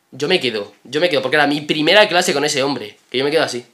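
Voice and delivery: ordinary delivery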